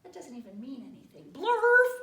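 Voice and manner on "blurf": very loud, high blurt; silly nonsense word. Acted. Reader forced to say nonsense, big loud silly blurt. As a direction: explode into a silly noise.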